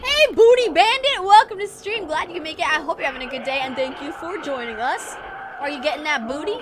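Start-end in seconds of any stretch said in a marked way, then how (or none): 0.0-2.1 excited greeting. Real, not acted. Greeting a chatter on stream.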